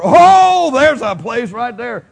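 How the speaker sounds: sudden high loud Oh, then slow; mock discovery. Preacher pretending to pick someone out, high excited cry. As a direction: play it like spotting a target.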